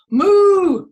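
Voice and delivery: loud, high, long moo; big hooting cow call. Shown as a demonstration. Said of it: "Everybody moo".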